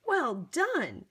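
plain reading voice

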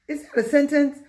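ordinary delivery

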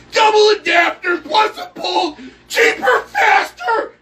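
high, slow, full-volume mock sales shout; super-duper car salesman. Salesman closing a supply house order, hammed-up shout. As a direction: holler each selling point like a manic salesman. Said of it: "like a super duper car salesman".

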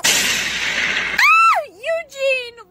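very high, loud shriek; horrified scream. Acted. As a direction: scream the name in horror. Screams after victim is jinxed.

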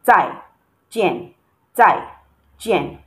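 "Tai" and "Jian" short and dropping, each apart; falling tones. Shown as a demonstration. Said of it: "the two tones here are the falling tones".

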